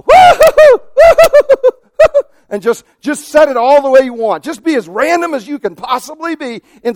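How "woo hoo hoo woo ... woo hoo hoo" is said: very high, loud mock hooting while looking away; silly high hoot. Shown as a demonstration. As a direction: hoot it out high and silly, like setting a watch at random. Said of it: "just look away and go".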